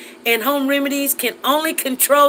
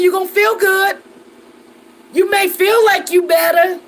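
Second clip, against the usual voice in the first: teasing; 5 semitones higher, 4 dB louder than usual. Real, not acted. Teasing about getting tipsy.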